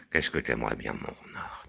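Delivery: soft teasing whisper; a playful unvoiced whisper. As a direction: breathe it close, coaxing.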